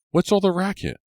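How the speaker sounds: put-on a carefree boy strolling in; Ricky. A narrator's reading. Ricky wandering into the clearing, high and offhand.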